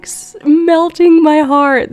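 gushing delight. Real, not acted. Gamer telling viewers the kiss melts her heart, gushing. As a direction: lean into every word with delight.

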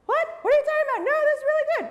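hammed-up shock; cartoon outrage at the doubter; high mock disbelief. Shocked pirate fan.

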